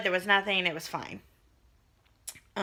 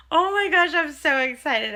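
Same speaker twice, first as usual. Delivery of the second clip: excited; 6 semitones higher, 5 dB louder than usual. Real, not acted. Excited about ultrasound.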